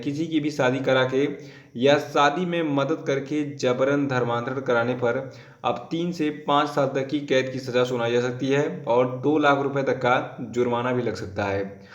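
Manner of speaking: normal talking voice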